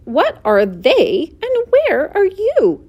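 drawn-out cry, leaping high; screaming question. A narrator's reading. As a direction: cry out each question slowly, pleading to know. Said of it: "screamed Wilbur".

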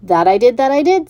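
a proud little goose. Acted. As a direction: goose confirming to the pig. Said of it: "said the goose".

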